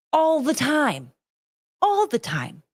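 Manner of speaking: climbing pitch, hammering it home; emphatic rant. Real, not acted. Ranting, repeating for emphasis.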